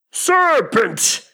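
high, loud bird screech; drawn-out shrieking accusation; screamed. A narrator's reading. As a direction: scream it as an outraged pigeon. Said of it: "screamed the pigeon".

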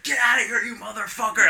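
high, loud re-enacted rage; retold screaming. Performer screaming at the heckler while held back, retold yell. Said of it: "I'm, like, screaming".

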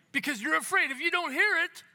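high, loud mock panic; mock alarm. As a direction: play up the viewer's fear, half joking. Mocking viewer's fear, louder, higher.

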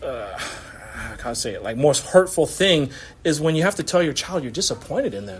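ordinary talk, nothing marked

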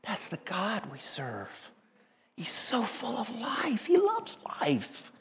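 breathy hushed whisper, slow; awed whisper. Real, not acted. Preacher to the congregation, slow awed whisper. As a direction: let the wonder fall to a whisper.

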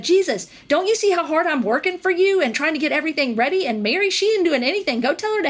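hammed-up overworked sister tattling; complaining Martha. Martha complaining to Jesus about Mary, mock whine. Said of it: "because Martha had said".

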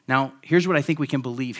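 normal talking voice

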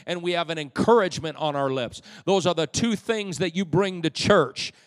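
loud, soaring sermon delivery; rising, ringing pulpit voice; full-force preaching. Acted. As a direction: preach it out to the whole room.

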